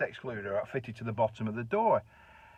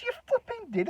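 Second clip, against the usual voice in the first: mock insistence. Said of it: "You know, joking like".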